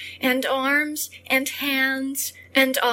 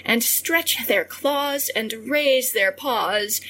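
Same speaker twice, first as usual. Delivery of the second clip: ordinary delivery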